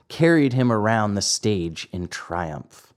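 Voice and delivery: ordinary delivery, nothing marked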